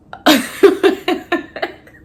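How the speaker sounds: big high burst of laughter; loud laugh. Real, not acted. Laughing at the boyfriend asking how she feels, loud laugh. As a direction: crack up loudly. Laughing at his follow-up text.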